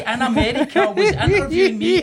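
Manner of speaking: high, loud laughing disbelief. Real, not acted. Host laughing in disbelief about the BBC interview, high and loud. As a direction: laugh it out, amazed.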